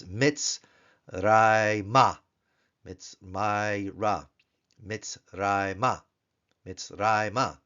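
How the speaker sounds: Mitzrayma, stress on RAY, ending ma; teacher modelling Mitzrayma; Hebrew Mitzrayma. Shown as a demonstration. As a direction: draw out Mitzrayma slowly. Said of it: "put on a directional Kametz He".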